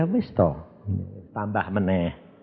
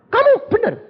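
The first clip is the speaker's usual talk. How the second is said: disbelieving question; 14 semitones higher, 3 dB louder than usual. Retold or imitated. Pastor doubting the report.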